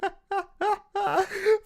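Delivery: high-pitched burst of laughter. Real, not acted. Streamer cracking up at a bought cameo, laughing.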